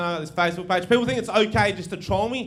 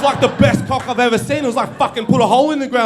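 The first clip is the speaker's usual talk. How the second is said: ordinary delivery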